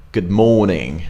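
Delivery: British "morning" with no R; non-rhotic British greeting; British accent. Shown as a demonstration. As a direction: drop the R, British style. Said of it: "So in British accent".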